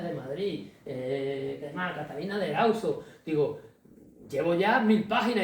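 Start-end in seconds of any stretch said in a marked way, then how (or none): 4.3-5.4 mock exasperated plea. Author pleading with his editor.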